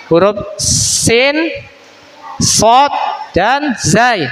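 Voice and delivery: Huruf sin, sod, dan zai drawn out very slowly, letter by letter; slow letter naming. Shown as a demonstration. Teacher names the three letters slowly for the class. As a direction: stretch each letter name so pupils catch it. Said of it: "Itu makhraj ketiga huruf".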